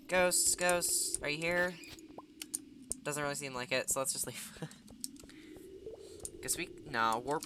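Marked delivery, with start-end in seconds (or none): 0.1-1.7 spooky calling out. Pretend. Calling out for ghosts. 4.6-6.4 breathy laugh. Real, not acted. Laughs after joke.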